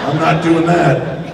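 emphatic slow no; slow stubborn refusal. Retold or imitated. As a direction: dig in your heels out loud. Refusing the ambulance.

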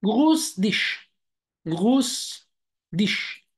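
جروس ديش said slowly as German grüß dich; German greeting demo. Shown as a demonstration. Teacher modeling grüß dich slowly for learners. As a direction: drag out جروس ديش, stressing جروس. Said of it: "كلمة جروس لوحدها بمعنى تحية".

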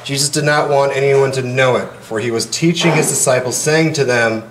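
steady formal gospel reading; scripture reading. Acted. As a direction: proclaim it to the congregation. Reads the gospel aloud.

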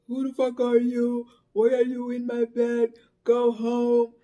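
loud, alarmed demand; retold drunk shout at an intruder; startled yell. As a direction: yell at the stranger on your bed. Stranger found sitting on her bed.

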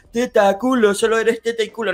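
a lightly voiced crude man's taunt; mocking a crude guy. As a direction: play the sleazy guy he was. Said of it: "era en plan".

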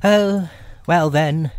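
high, slow, relenting rabbit. Acted. Rabbit relenting at the door, high slow voice. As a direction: give in slowly in a high voice. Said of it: "said Rabbit".

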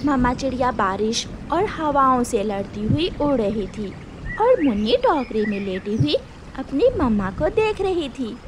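plain reading voice

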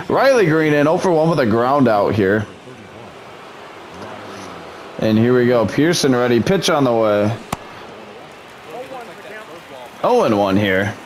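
plain. Acted.

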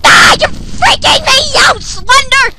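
high, loud panicky shouting; freaked-out yell. Real, not acted. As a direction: plead in panic. Panicking at Slenderman.

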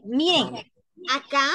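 ordinary talk

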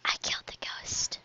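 breathy whispered secret; hushed whisper of a win. Real, not acted. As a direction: whisper it so no one hears. Whispered in game.

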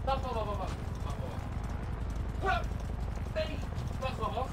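high, faint repeated calls from away; distant, hurried muttering. Real, not acted. Streamer trying a handstand off-mic, distant and faint. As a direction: stall them from across the room, very soft.

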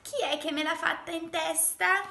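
high, loud indignant demand; indignant exclamation. A narrator's reading. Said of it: "esclamò la piccola talpa". (esclamazione indignata)

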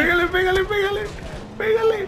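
high frantic urging; panicking gamer. Real, not acted. Frantic shouting during fight.

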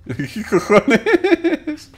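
slow, stretched laugh through the words; laughing disbelief. Real, not acted. Laughing at the climb.

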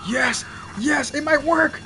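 high, hopeful cheer; excited shout. Real, not acted. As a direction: shout it with rising hope. Excited that the plan works.

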